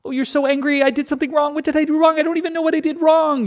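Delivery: high, anxious mock panic, rushed at the end; hammed-up fearful whine, high and quickening. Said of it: "God's the abusive boyfriend".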